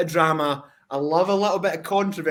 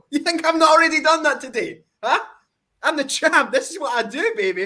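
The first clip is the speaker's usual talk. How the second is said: much higher and louder wrestler promo yell; fired-up shout; 10 semitones higher, 5 dB louder than usual. Real, not acted. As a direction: blast it like a champ cutting a promo. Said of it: "I'm a little bit fired up tonight".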